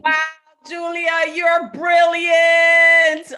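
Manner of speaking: loud, high, slow cheer of praise; excited shout. Real, not acted. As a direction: shout her name out in delight. Host cheering the poet.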